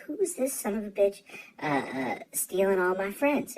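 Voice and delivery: mock indignation. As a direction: retell it as an affronted, jealous grumble. Retelling first jealous reaction.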